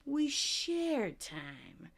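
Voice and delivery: slow, dreamy hippie drawl. Retold or imitated. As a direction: stretch it out like a mellow hippie. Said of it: "a mild impression of a hippie".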